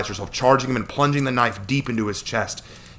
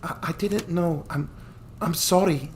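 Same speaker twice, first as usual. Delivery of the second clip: stammered apology; 9 semitones higher than usual. Acted.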